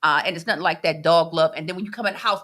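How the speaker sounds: ordinary talk, nothing marked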